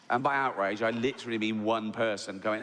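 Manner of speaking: ordinary delivery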